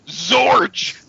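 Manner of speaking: loud high zapping beam sound; laser blast zap. Acted. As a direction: fire the beam with a sharp zap.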